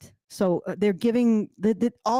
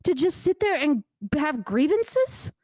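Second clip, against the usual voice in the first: exasperated; 7 semitones higher than usual. Retold or imitated. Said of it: "I'm like".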